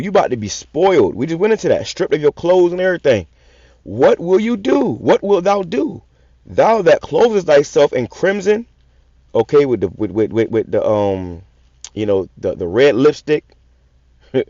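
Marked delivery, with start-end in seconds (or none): 3.8-8.7 slow, weighty reading of a verse; scripture reading. Acted. Preacher reading a verse to his listeners, slow and weighty. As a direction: read the verse slowly, like a preacher.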